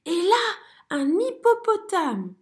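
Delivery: aigu et fort, lent; s'émerveiller encore; émerveillé. A narrator's reading. Zigomar marvels. (merle émerveillé)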